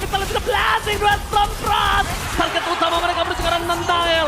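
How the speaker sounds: high, loud stretched caster yells; hype shout. Real, not acted. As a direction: scream the teamfight call. Caster calling teamfight. (teriakan komentator heboh)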